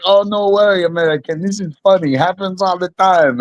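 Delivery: mock accented high voice of a local host; lightly playing the carefree local, amused; Kazakh host. Local host brushing off the fight, mock accented. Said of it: "And he's like".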